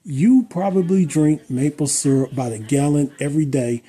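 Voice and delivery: hammed-up gushing ad read for a fellow YouTuber; mock sponsor ad read. Vlogger thanks a fellow creator in a mock sponsor read, gushing. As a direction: sell it like a cheesy fake sponsorship.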